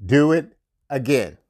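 slow and deliberate, word by word. Shown as a demonstration. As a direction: spell it out slowly for them. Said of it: "so I'm gonna say it slow".

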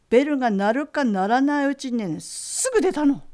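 telling the miracle with a burst of excitement; excited. Real, not acted. Phone answered instantly, excited retelling.

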